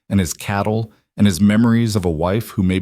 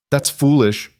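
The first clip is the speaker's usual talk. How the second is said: edge of anger; 6 semitones higher than usual. Acted. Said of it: "Jonah's voice carried an edge of anger".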